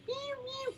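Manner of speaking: tiny, high, soft kitten mewing; very high little mews; kitten freaking out. Retold or imitated. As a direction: imitate a frightened kitten's thin meow. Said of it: "you know, freaking out".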